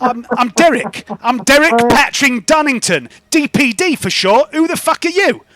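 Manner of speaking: high, loud, defiant comeback; indignant delivery driver. Acted. Driver naming himself to the shopkeeper, defiant. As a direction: stand your ground, rising to a challenge.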